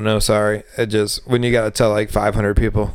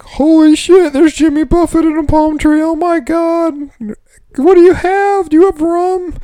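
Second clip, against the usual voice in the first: much higher, louder, gushing tourist; excited tourist; 5 dB louder than usual. Retold or imitated. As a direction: squeal like a starstruck tourist at the bar. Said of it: "walk up to the bar and be like".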